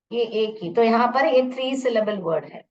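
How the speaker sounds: ordinary talk, nothing marked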